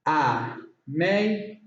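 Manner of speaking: AMAZING slowly, syllable by syllable with gaps; broken into syllables. Shown as a demonstration. Teacher counting three syllables, AMAZING broken apart. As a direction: split AMAZING into three separate beats. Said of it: "Three syllables".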